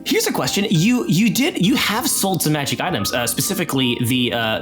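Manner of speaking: usual speaking voice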